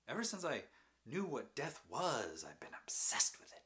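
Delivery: loud, high-pitched confession; anguished outpouring. Acted. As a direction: pour it out loudly, pitch swooping up. Anguished confession after laughing outburst.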